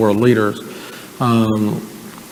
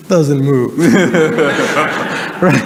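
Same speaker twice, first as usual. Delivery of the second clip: ordinary talk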